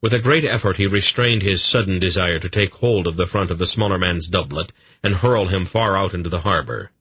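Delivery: ordinary delivery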